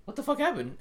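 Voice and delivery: very high, incredulous squawk; mock shock. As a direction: imitate stunned disbelief in a high voice. Acted quote, shocked Beast Titan.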